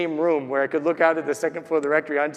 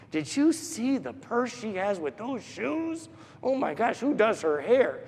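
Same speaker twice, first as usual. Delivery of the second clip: imitated gossiping women; 3 semitones higher, 4 dB quieter than usual. Women in the kitchen at a party, imitated gossip. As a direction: play a catty kitchen gossip, lilting.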